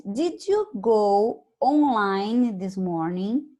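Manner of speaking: did you go online this morning read very slowly. Shown as a demonstration. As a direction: read the question slowly for the class. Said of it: "teacher reads question".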